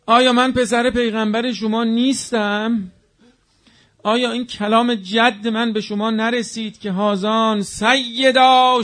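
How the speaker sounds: preacher voicing Hussein's lament; pleading elegy. Voices Hussein pleading to army.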